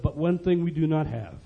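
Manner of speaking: soft, understated lead-in to a point; quiet admission. Retold or imitated. Finance minister confiding what is lacking, quiet. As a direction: hold it back, softly.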